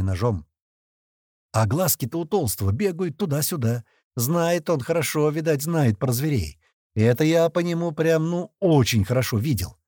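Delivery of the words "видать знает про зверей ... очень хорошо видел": ordinary delivery, nothing marked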